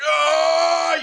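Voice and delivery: high strained "Ah!"; high mock battle cry; heroic effort yell. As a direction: yell as if heaving something huge. Voicing a cliff-hanging child throw.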